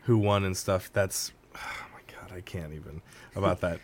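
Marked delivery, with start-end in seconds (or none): 1.5-3.0 breathless whispered dismay; whispering. Real, not acted. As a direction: drop to a whisper. Dreading a Game Awards spoiler.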